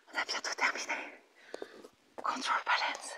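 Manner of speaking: very soft, voiceless whisper; whispered to oneself. Real, not acted. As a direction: whisper it while checking your notes. Reading her notebook to herself. (chuchoté pour soi-même)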